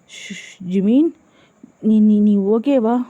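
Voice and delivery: halting, stammered worried question; flustered concern. A narrator's reading. Suga wakes with a start and checks on Jimin, flustered. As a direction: stumble anxiously over the words. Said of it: "பதறி போய் எழுந்து".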